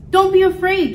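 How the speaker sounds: high-pitched call to the crowd; lightly acted showman reassurance. A narrator's reading. Scientist calming a crowd.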